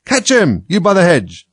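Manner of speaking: urgent, high, loud command; rabbit's shout. A narrator's reading. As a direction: yell across to someone far away. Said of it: "Then the rabbit's voice alone".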